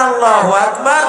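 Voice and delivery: rally the crowd with a big call; loud shout. Real, not acted. Preacher commanding the crowd to shout the takbir, loud shout. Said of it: "জোরে বলেন".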